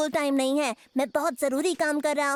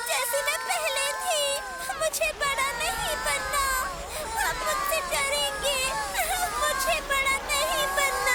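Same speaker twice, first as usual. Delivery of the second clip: put-on childlike crying; 8 semitones higher than usual. Franny begs her friends to make her small, crying. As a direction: plead through childish sobs. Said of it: "रोना बंद करो, तुम बच्चों जैसी हरकतें कर रही हो".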